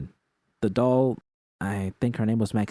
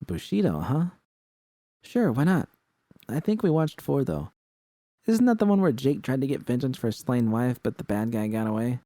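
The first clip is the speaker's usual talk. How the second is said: a hint of a different voice, higher, amused recall; protagonist, lightly voiced; 5 semitones higher than usual. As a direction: lift the voice up for the narrator-hero. Protagonist answers V.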